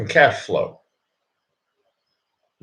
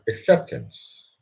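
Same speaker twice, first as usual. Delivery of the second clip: "Acceptance." drawn out slowly and softly; slow right down on "Acceptance."; slower retake; 2 semitones lower, 5 dB quieter, slower than usual. Shown as a demonstration. His answer to anybody, slow and soft. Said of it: "Let's try that again".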